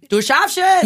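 high, loud cheer in regional German dialect. Pretend. Friend records a motivational reminder on request, dialect cheer. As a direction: belt out encouragement in your home dialect. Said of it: "mit deinem Dialekt wär mir wichtig".

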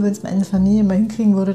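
ordinary delivery

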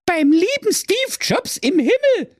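put-on very high shocked girlish cry; sister cries out. Sister shocked by the curse, very high cry. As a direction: squeal in alarm. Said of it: "rief dem Brudi sein Schwesterlein".

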